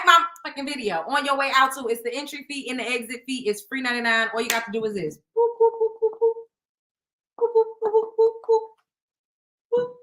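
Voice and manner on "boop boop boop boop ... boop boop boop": high, even little boop noises; button-press sound effect; playful boops. Pretend. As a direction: tap out tiny high-pitched beeps. Imitates button presses for likes.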